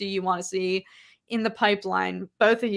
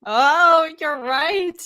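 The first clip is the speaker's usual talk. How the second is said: sudden realization, louder and higher; surprised agreement; 7 semitones higher, 7 dB louder, much slower than usual. Real, not acted. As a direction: blurt it out as it dawns on you. Realizing the friend's point.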